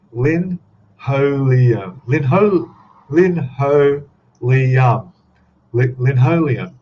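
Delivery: "Lin Ho Liam" spelled out very slowly and loud, syllable by syllable; sounding out a name. Shown as a demonstration. Stumbling over a viewer's name on stream, slow and loud. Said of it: "That doesn't sound right".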